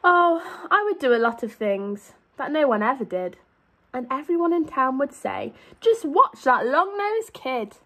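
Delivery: lively, sing-song storybook read; animated picture-book reading; reading to children. A narrator's reading. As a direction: read it out to a class of little kids.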